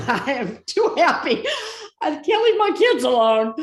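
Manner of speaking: high, loud, pleading cry with a laugh; retold frantic plea from the burning car; desperate plea. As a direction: beg the archangel loudly to save you. Begging for help in burning car.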